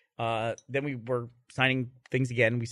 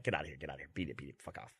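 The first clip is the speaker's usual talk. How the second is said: low dismissive mutter; 10 semitones lower, 15 dB quieter, faster than usual. Retold or imitated. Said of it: "She has to be like".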